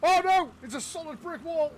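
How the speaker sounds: sudden high yelp of alarm; alarmed cry. Real, not acted. Crashing plane into a wall.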